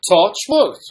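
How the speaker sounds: high, loud sing-song Welsh accent; exaggerated Welsh accent, pitched right up. Shown as a demonstration. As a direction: belt it out in a put-on Welsh accent. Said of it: "suppose I could do the accent".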